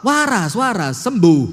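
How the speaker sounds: loud, pushy plea for healing; impatient demand for healing; demanding prayer. Retold or imitated. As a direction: beg God bluntly, like ordering a cure. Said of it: "Ndak seperti kita, kita doa langsung".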